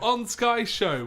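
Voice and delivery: high, slow announcer voice; drawn-out show host intro; posh radio voice. Pretend. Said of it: "such a posh voice today".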